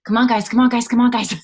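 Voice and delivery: loud rallying call to her team; cheering on the team. Retold or imitated. Coach rallying her business team, loud and eager. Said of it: "I'm like".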